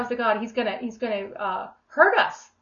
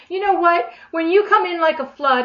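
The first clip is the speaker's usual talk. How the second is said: defiant; 7 semitones higher, 7 dB louder than usual. Acted. Defiant address to the enemy.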